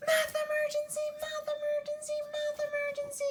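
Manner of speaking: very high mock-panic alarm chant; alarm call. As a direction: chant it like a siren. Teacher calls a math emergency.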